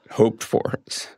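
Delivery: real tearful near-whisper after a long pause; choked up, crying. Real, not acted. Son recalling his late father's hopes for his children, choked up. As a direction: barely get it out through tears. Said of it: "you don't cry with anyone else".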